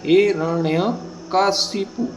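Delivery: Hiranyakashipu drawn out syllable by syllable; slow model word for students; slow careful pronunciation. Shown as a demonstration. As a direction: stretch Hiranyakashipu very slowly. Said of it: "तो हिरण्यकशिपु होता है".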